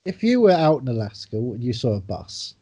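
ordinary delivery

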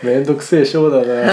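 laughing. Real, not acted. Host laughs at the dispute.

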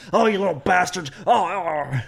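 high, loud shouting rant; bullhorn rant. Retold or imitated. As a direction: yell it like a crazed bullhorn preacher. Alex Jones on bullhorn impression.

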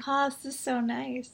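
soft, high, drawn-out sigh of pleasure; blissful relief. Retold or imitated. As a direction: slowly melt into mock bliss. Retold relief from jaw rolling.

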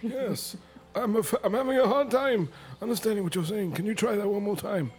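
high squeaky polite voice; squeaky cartoon voice. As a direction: ask it back high and squeaky. Player answers elf in character.

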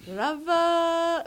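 lightly imitated Indian accent on Rava; a hint of an Indian cook's accent; shop owner's Indian accent. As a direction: say Rava the way the cook did, lilting. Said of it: "I'm trying to do the accent".

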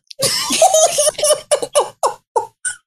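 shrill cackle; loud high laughing fit; high shrieking laugh. Real, not acted. Laughing at cohost.

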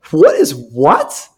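slow, drawn-out mock disbelief. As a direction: stretch it out, baffled. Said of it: "I'm like".

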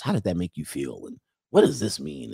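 low imitation of a therapist; lightly voiced probing psychologist; mock psychologist. Comic mocking ladies' talk, mock-psychologist. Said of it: "like a damn psychologist".

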